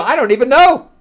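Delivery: high-pitched, loud bafflement; over-the-top frantic cluelessness; hammed-up exasperation. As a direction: squeal it out, at a comic loss. Reviewer exasperated at having no story.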